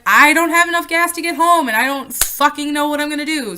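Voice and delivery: high, loud desperate confession; panicked outburst. Retold or imitated. Stranded daughter admits to mom she's out of gas, panicked shout. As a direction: blurt it out, scared and stranded.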